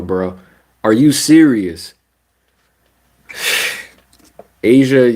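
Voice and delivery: ordinary talk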